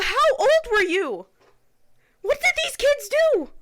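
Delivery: high, tearful, anguished voice; real crying. Real, not acted. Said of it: "I'm sorry if I'm crying".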